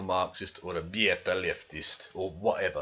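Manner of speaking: put-on a brief, lightly done German accent; tossed-off jokey German accent. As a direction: slip into a mock German accent. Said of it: "I said that in a German accent".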